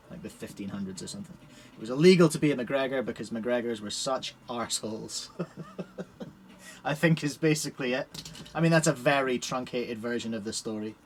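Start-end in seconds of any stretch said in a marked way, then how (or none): 5.3-6.3 breathy silent laugh. Real, not acted. Laughs at his clan story.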